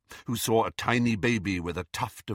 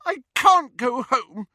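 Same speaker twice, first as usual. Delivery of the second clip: high, loud, slow cry; shouting; 14 semitones higher, 7 dB louder, slower than usual. A narrator's reading. Daughter shouting at her mother, high and tearful. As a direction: shout it through angry tears. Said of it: "Ginny shouted".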